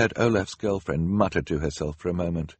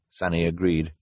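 usual speaking voice

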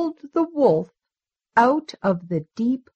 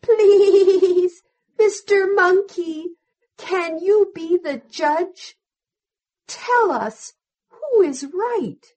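pleading sheep; 8 semitones higher, 4 dB louder than usual. A narrator's reading. Sheep asks the monkey to judge, high bleating plea. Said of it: "The sheep said".

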